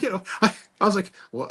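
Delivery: ordinary delivery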